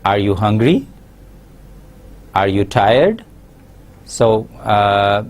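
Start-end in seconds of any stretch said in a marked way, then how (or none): 0.0-3.2 yes-no questions rising sharply on "hungry" and "tired". Shown as a demonstration. Teacher demonstrating yes-no questions, rising at "hungry" and "tired". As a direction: lift the pitch on the final "hungry" and "tired". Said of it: "usually our tone rises".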